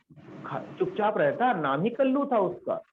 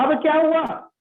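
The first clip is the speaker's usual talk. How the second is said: surprised question; 9 semitones higher, 6 dB louder than usual. Retold or imitated. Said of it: "हमने कहा".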